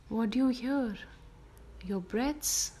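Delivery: ordinary talk, nothing marked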